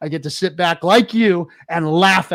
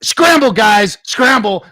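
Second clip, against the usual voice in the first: loud, higher mocking call; taunting shout; 6 semitones higher, 8 dB louder, slower than usual. Real, not acted. Host jeering at rivals to scramble, loud taunt. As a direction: jeer at them to run.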